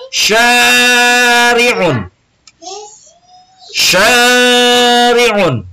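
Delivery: "Syari'un" drawn out high and long for students to copy; model pronunciation. Shown as a demonstration. Teacher models "Syari'un" for the class to repeat. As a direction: sound out "Syari'un" slowly, high and clear. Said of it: "ikuti Bapak".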